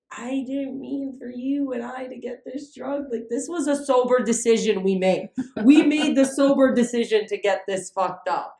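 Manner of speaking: rising defensive rant; excited pleading. Retold or imitated. Drunk self making excuses.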